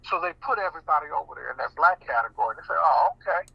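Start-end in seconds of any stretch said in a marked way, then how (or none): none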